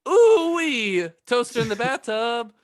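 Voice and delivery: ordinary talk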